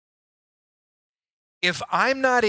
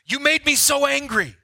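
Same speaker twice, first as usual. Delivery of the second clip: mock angry excuse; 8 semitones higher, 5 dB louder than usual. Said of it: "and they just said".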